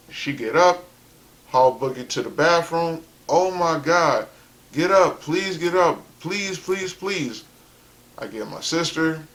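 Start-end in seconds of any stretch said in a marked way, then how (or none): 3.3-7.4 higher-pitched, urgent begging; imitating panicked pleading. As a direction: plead like a frightened mother, repeating it. Imitates mom pleading over collapsed dad.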